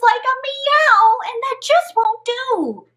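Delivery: high, loud pleading frog voice. Acted. Frog begs Wanda for a potion, high pleading. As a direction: beg the witch for help, high.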